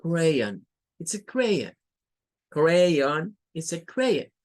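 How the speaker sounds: crayon with a soft ending; it's a crayon, softened final vowel; practicing it's a crayon. Shown as a demonstration. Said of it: "muy suave al final".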